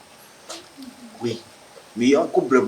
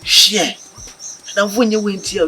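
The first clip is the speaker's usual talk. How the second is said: insistent; 8 semitones higher than usual. Acted. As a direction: press the point on your friend. Insisting during an argument.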